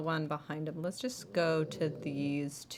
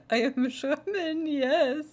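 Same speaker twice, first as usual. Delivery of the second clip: mock German accent; 8 semitones higher, 7 dB louder, slower than usual. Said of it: "This is how much German I speak".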